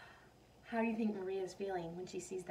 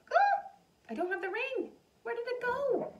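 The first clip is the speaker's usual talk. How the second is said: panicked realization; 14 semitones higher, 4 dB louder than usual. Retold or imitated. Retells Maria's panic.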